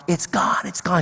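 hushed, high mock weeping; retold weeping. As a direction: softly imitate someone crying with relief. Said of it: "She is weeping. She is crying".

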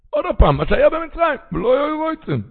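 rising preacher's lament; high pleading cry. Acted. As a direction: lift the pitch high, pleading. Preacher rises sharply.

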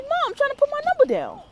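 high, loud mock-whine to mom; retold plea to mom. Telling mom about the number, high exasperated plea. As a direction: pitch it way up, calling to Mom.

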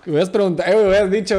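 loud, high laughing tease. Real, not acted. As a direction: tease him through laughter. Laughing teasing his friend. (riendo a carcajadas)

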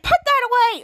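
high, loud order; mock scolding. Charlotte telling Schwoz off, mock scolding. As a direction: snap it out in acted annoyance.

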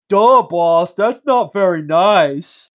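slow, dopey lunk saying duh; dumb henchman voice. Retold or imitated. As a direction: play the thick, slow-witted henchman. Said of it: "and he'd be like".

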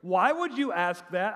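sarcastic. Acted. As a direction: challenge him with mock disbelief. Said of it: "a little bit of sarcasm".

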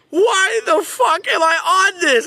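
high, loud, slow disbelieving shout; panicked yell. Retold or imitated. Kid on a bucking horse yelling in disbelief, high and loud. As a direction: yell it in exasperation from the saddle.

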